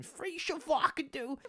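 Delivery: drawn-out, very high silly voice; goofy falsetto gibberish; silly nonsense cry. Pretend. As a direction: squeal it slowly like a daft catchphrase. Clowning with nonsense words.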